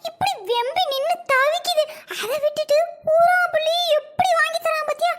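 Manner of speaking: high-pitched aggrieved complaint; indignant grumbling. Acted. Wife watching husband buy another child cones, indignant. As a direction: carp jealously about the favouritism.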